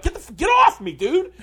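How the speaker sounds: loud, high-pitched exasperated shout; hammed-up yell to shake someone off; mock protesting yell. Actor caught in trainer's arm bar, mock protesting yell. Acts victim fighting off trainer.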